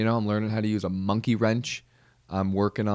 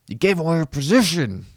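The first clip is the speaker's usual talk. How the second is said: higher, hammed-up exasperated chewing-out; mock scolding; 7 semitones higher, 4 dB louder than usual. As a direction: blame him like a fed-up squad mate. Scolding the vaping squad mate.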